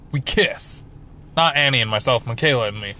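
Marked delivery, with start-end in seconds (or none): none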